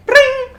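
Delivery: very high, loud ringing noise; imitated phone ring. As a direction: mimic a phone going off. Imitates phone notification going off.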